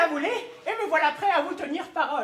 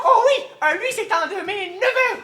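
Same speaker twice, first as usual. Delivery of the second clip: nervous laugh; 6 semitones higher, 9 dB louder than usual. Acted. Flustered father covers up.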